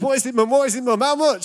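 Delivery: high, loud, pressing; teen begging to hear the windfall; eager teen. Retold or imitated. Teen son pressing mum about money, high and loud. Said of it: "so I said".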